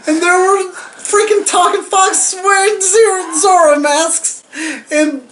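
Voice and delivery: loud, high, giddy slurred rave. Real, not acted. Excited drunken movie rave.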